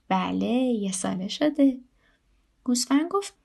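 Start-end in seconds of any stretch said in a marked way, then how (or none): none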